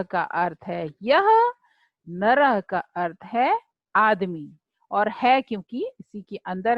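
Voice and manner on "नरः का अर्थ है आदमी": normal talking voice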